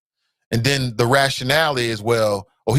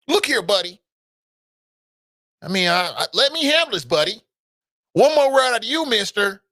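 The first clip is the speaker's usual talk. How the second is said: condescending cop; 8 semitones higher than usual. Retold or imitated. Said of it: "and the officer's like".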